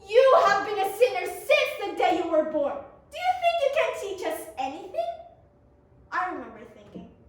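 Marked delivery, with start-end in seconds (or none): 0.1-5.1 loud, hammy furious scolding; furious, scolding, rushed at end. Acted. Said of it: "The Pharisees were furious".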